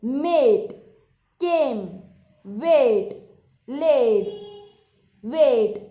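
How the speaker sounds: each word said loud, with a long ay vowel; मेट केम वेट लेड वेट with the ay sound; clear vowel demo. Shown as a demonstration. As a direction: model the words slowly for learners. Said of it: "देखते हैं कैसे".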